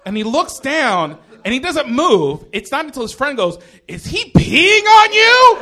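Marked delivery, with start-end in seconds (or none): 3.9-5.6 very high, drawn-out shriek; shocked disbelief. Retold or imitated. Comic mimics the friend seeing the pee, slow shocked shriek. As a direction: shriek it slowly, wildly incredulous.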